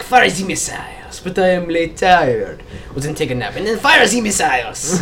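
put-on slow French-accented commander, fire the missiles; mock French accent. Friend retells a cartoon French commander, slow mock French accent. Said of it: "and the French are like".

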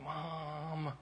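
soft, drawn-out whine; whining kid. Retold or imitated. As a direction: complain to mom like a reluctant child.

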